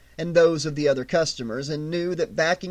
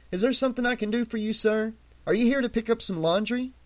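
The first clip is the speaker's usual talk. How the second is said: higher, fast, overly polite shop clerk; bright customer-service voice, higher and quick; 6 semitones higher, faster than usual. A narrator's reading. Arlen politely addresses the customer.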